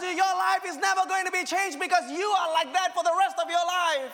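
high, loud preacher's cry building through the lines; rising, loud sermon delivery; full-force preaching. Acted. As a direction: preach it out loud to the whole room.

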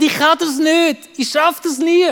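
high-pitched child's protest, imitated; mock wail of giving up; defeated protest. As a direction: imitate a child insisting he can't. Son protesting he cannot ride.

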